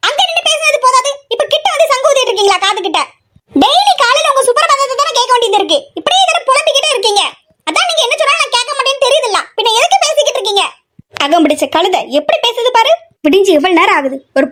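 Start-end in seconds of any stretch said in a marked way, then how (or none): 0.1-10.6 high, whiny daughter talking back; annoyed daughter. Acted. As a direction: sulkily complain about mother's nagging. Daughter role, annoyed retort. (எரிச்சலுடன் திருப்பிப் பேசும் மகள்)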